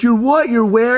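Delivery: high, loud disbelieving mother; imitating mom's shocked squeal. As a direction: mom can't believe her ears. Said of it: "She's like".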